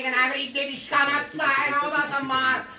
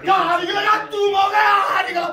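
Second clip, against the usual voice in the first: rallying shout; 6 semitones higher than usual. Real, not acted. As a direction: pump the players up, then curse the coach. Tifoso incita la squadra. (incitamento urlato, acuto)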